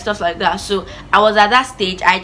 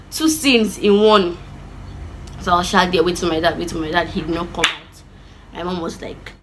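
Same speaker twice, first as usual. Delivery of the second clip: usual speaking voice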